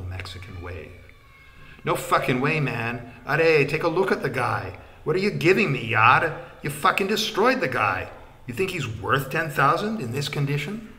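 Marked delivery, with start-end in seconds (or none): 1.8-10.9 outraged haggling. A narrator's reading.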